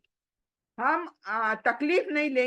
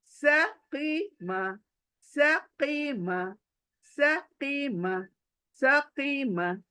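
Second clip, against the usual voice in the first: slow drill; much slower than usual. Shown as a demonstration. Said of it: "फिर आगे जाकर practice fast करो".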